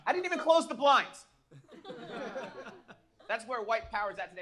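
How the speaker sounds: ordinary delivery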